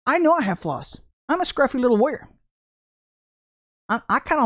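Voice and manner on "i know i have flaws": ordinary talk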